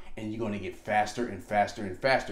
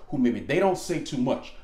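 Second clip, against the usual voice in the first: ordinary talk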